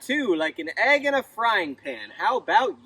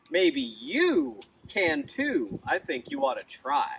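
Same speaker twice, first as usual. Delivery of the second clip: ordinary delivery, nothing marked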